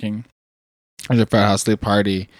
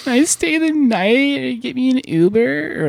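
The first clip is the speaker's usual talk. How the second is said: put-on very high drunk party girl; squeaky lost freshman girl; slower than usual. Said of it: "stupid, slutty freshmen, like".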